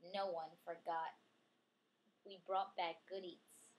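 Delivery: ordinary delivery, nothing marked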